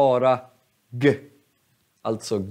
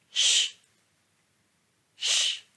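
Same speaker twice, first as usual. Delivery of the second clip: a drawn sh hiss, softer; soft sh sound; 4 dB quieter than usual. Shown as a demonstration. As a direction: demo the sh that k becomes. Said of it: "K-et blir". (tonlöst sje-ljud)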